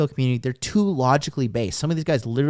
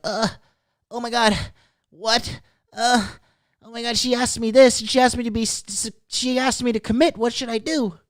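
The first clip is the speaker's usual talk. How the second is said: higher, flustered mock-nervous guy; mock panic; 8 semitones higher than usual. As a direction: play a tightened-up guy freaking out over a commitment question.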